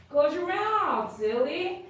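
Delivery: high, drawn-out swooping coo; playful pet scolding. Real, not acted. Groomer teasing a dog to close its mouth, high playful singsong.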